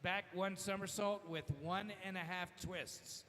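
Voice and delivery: announcer over the pool PA; echoing PA announcement; diving announcer echo. Real, not acted. PA announcer reads scores and dive.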